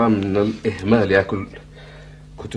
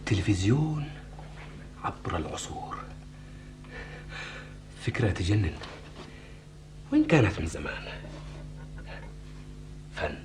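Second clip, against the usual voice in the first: quiet musing; 3 semitones lower, 13 dB quieter than usual. Acted.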